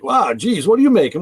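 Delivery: mock-amazed coworker; imitated amazement. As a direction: mimic an astonished, curious coworker. Retold coworker's amazed question at lunch.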